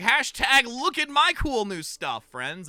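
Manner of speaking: high, loud, drawn-out showing off; mock bragging post. Streamer showing new setup to friends, mock bragging. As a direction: announce it in a hammed-up social post voice. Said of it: "then I can be like".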